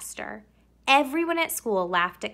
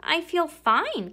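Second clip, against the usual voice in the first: put-on higher, slow little-girl reply; girl's voice; 8 semitones higher, 3 dB louder, slower than usual. Camilla reassuring her mother, higher slow girl voice. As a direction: answer like a small girl. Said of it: "Camilla answered".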